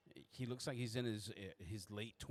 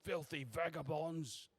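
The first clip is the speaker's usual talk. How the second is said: high, loud shout at the rabble, easing off at the end; contemptuous holler, trailing into a grumble; scolding doorman; 7 semitones higher, 5 dB louder than usual. Acted. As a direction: shoo them off with a pitched-up yell. Doorman shooing tramps from the line.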